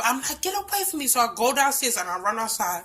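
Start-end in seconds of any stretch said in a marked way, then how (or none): 0.4-1.1 panicked cry. Retold or imitated. Woman backing away from her attacker, high and frightened. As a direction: cry out in fear as he closes in. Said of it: "that's when I started sp".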